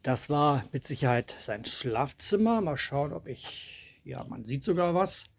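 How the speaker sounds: ordinary delivery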